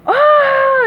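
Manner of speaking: loud high "ah" scream; long high cry of fright; terrified yell. A narrator's reading. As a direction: scream in cartoon terror. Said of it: "yelled Patrick".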